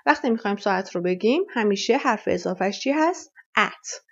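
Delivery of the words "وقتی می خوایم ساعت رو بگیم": normal talking voice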